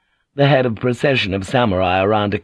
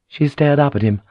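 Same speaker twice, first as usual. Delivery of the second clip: ordinary delivery, nothing marked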